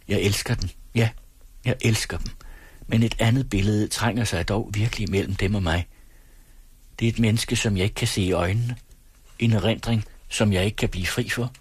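usual speaking voice

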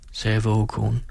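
usual speaking voice

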